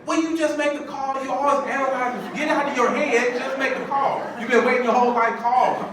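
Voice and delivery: high, loud mock tirade from a fed-up wife; high-pitched nagging yell, hammed up; exasperated wife. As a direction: imitate your wife shouting you into action. Wife's exasperated rant, acted.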